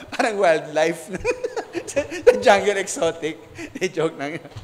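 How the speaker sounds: chuckling through the joke; laughing. Real, not acted. Pastor laughing at his own jungle joke to the congregation, laughing. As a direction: laugh it out while joking.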